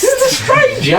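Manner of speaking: very high, squeaky figure voice; gnome voice. Acted. Gnome tells the household of a visitor, high squeal. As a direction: pitch it far up, comically.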